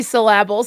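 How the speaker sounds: "syllable" said as sil-LAB-le; joke mispronunciation. Retold or imitated. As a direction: stress the middle of "syllable" as a joke. Joking about misplaced stress in syllable.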